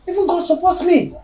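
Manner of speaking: high-pitched, emphatic repeat; insistent vindication. Acted. Spouse insisting God is on their side, high emphatic cry. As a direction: push the whole line up high.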